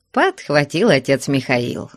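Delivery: ordinary delivery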